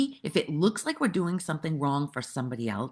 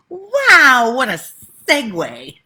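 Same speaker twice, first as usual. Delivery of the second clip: sudden high, loud wow; delighted at her own segue; a burst of amused amazement; surprised wow; 10 semitones higher, 16 dB louder, slower than usual. Real, not acted.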